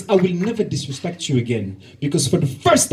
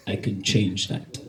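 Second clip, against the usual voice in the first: low seductive line; 8 semitones lower, 8 dB quieter, slower than usual. Retold or imitated. Man's seductive reply.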